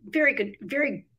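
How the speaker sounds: a real lump in the throat; choked up. Real, not acted. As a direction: voice catching with emotion. Recalling friend's moving story.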